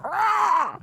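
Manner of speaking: high mock yell "Rah!"; short, high cartoon yell; Grievous yell. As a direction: imitate the movie villain's cry. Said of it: "and he's like".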